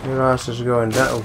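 ordinary talk

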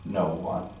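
low and soft, small; a quiet sheepish answer; meek reply. Retold or imitated. Wife answering husband on the phone, low and soft.

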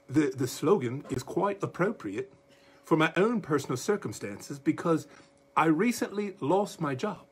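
joking, hammed-up British accent. Preacher quotes the woman for his congregation, terrible British accent. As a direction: do a comically bad British accent. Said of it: "I'll do my British accent".

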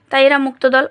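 normal talking voice

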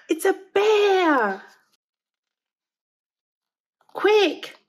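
high, loud frightened cry; panicked. A narrator's reading. Discovering the bear.

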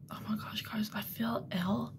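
breathy nervous whisper; nervous excitement. Real, not acted. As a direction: whisper it, jittery and queasy. Nervous before going out.

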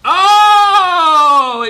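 high, loud surprised cry; sudden loud gasp of shock; startled shout. Real, not acted. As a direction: blurt it as something blows up. Sudden explosion in game.

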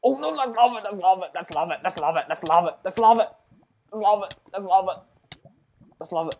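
rapid-fire alarmed repetition, loud; panicked. Real, not acted. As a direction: blurt the warning over and over in panic. About to hit lava in game.

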